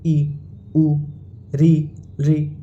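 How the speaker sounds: ई, उ, री, री each one separate beat; short vowels. Shown as a demonstration. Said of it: "एक मात्रा काल". (ई, उ, री, री ह्रस्व स्वर में)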